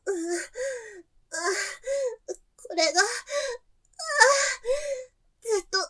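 high, breathless, moaning between words; aroused gasping. Acted. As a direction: pant through each phrase with pleasure. Lovemaking, gasping. (高い声で喘ぎながら)

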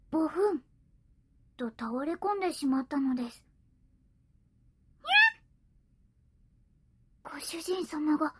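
ordinary delivery

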